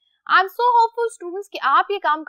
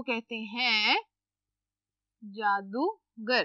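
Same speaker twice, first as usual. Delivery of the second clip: slow and soft; 7 semitones lower, 9 dB quieter, much slower than usual. Shown as a demonstration. Teacher models Urdu meaning slowly.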